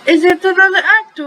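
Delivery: put-on high, eager child asking Mama; excited kid voice. A narrator's reading. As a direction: burst out with curious, high-pitched questions. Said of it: "Feliciano asked excitedly".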